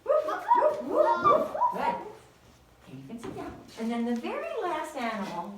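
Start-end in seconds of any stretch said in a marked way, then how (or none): 0.0-1.5 very high, loud woofs like a little dog. Acted. Teacher playing the dog for the kids, high loud woofs. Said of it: "the dog says".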